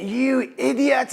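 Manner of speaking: high, slow, furious cry; stretched, high-pitched angry shout; angry outburst. Acted. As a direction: yell it out in outrage at the fool. Creator trips and loses tooth.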